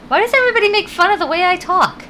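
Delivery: high, loud, fast protest; a rushed, high-pitched whine of protest at being teased; mock exasperation. Streamer protests to viewers teasing her speech, mock-exasperated.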